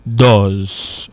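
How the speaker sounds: "does" low and drawn out, open vowel as in "duhz"; strong pronunciation. Shown as a demonstration. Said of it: "la prononciation est très forte". ("does" pwononse fò, ak vwayèl louvri: "doz")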